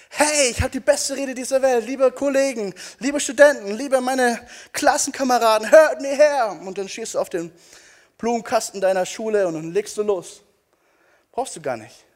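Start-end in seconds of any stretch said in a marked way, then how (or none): 0.1-6.6 loud, high mock-oratory, building to a call; grandstanding speechmaker. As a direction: proclaim it like a big speech to a crowd. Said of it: "nicht groß aufzudrehen und sagen". (großspurige, übertriebene Volksrede)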